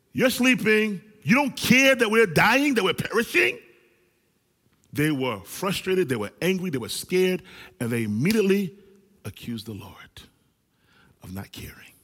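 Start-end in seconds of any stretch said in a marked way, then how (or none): 0.1-3.6 frightened, loud, high accusation; panicked accusing cry. Retold or imitated. Disciples in the sinking boat accuse Jesus, loud and panicked. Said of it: "the first thing they say is". 9.2-11.9 whisper. Acted. Dramatic hushed aside.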